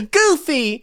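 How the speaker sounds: high, drawn-out name call; put-on high hero voice. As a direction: call a friend like a game hero. Acting game hero calling Goofy.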